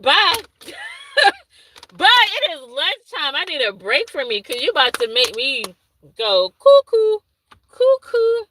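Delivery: put-on high, sing-song goodbye to the baby; mock escape. Fleeing the baby at lunch break.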